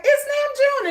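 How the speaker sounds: high, drawn-out excited call; light squeal on spotting him; excited recognition. Retold or imitated. Dreamer spots him at a party, excited squeal.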